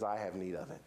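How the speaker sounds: low, soft and steady; quiet firm answer. Acted. Jesus looking at you and answering, quietly firm.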